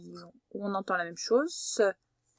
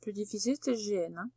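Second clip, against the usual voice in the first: usual speaking voice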